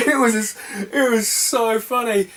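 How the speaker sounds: high, drawn-out delight after a laugh. Real, not acted. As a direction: still tickled, stretch it out. Cracking up at own story.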